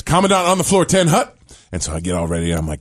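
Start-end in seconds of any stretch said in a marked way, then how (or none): 0.0-1.4 lightly acted cadet calling attention; military call. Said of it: "and the line was".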